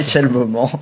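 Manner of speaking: bursting out laughing mid-phrase. Real, not acted. Laughing loudly with friends on the podcast. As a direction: laugh through the words, high and loud.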